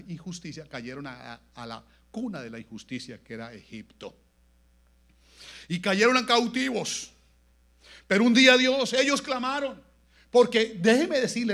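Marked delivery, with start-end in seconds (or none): 5.7-11.5 loud, high-pitched exhortation; full-force preaching. Real, not acted. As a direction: drive each phrase home slowly and forcefully.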